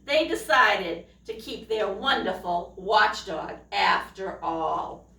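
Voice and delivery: ordinary delivery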